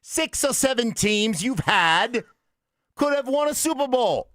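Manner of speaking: high, slow mock English reporter accent; hammed-up English accent, stretched out slowly; British reporter. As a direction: ham up a British journalist asking the question. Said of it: "He started out English".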